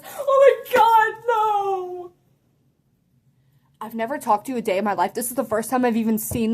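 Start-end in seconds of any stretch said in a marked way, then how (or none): 0.0-2.1 high, loud, drawn-out mock wail; appalled shriek. Kid horrified at sitting by her, mock shriek. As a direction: wail it in exaggerated horror.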